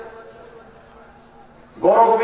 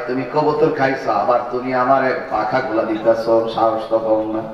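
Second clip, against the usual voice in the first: low, seething uncle scolding; angry uncle; 11 semitones lower than usual. Retold or imitated. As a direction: scold the nephew low and sharp. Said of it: "কয়".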